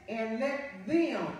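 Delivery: "them" stretched slowly and set apart; stress on THEM. Shown as a demonstration. Preacher pointing congregation to a Bible word, stressing "them". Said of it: "please hear that word".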